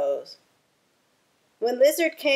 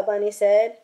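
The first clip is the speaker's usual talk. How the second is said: usual speaking voice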